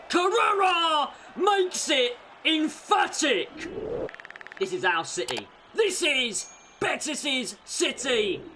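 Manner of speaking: building to a high, loud, drawn-out goal roar; goal celebration. Real, not acted. Fan commentator roaring as Carrera seals the derby, ecstatic. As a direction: belt out the third goal.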